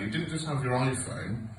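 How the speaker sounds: normal talking voice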